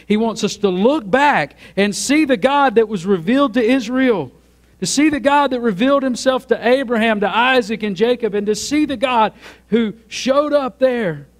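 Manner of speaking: high, driving sermon crescendo; ringing pulpit proclamation, sustained high; full-force preaching. Real, not acted.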